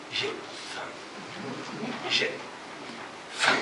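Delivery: voiceless whisper, word by word; hungry belly cry. Acted. Hungry belly whispering to Hiroshi, voiceless. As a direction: breathe it like an empty stomach. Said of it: "qui crie".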